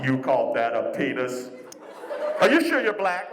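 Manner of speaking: toilet insulting a man, deep and scornful; mocking toilet voice. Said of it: "It's like".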